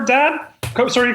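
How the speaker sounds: ordinary delivery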